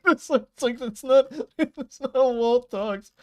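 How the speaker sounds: cracking up while explaining the clip; laughing. Real, not acted. Laughing at his own voice clip.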